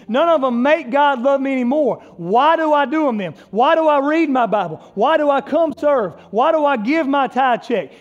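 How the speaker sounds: high, loud, driving sermon delivery; loud impassioned preacher; full-force preaching. Real, not acted.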